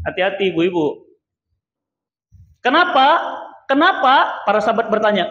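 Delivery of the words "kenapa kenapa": higher, louder asking why; raised question. Acted. Preacher calls out to the congregation, louder and higher. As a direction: call out the question to the crowd.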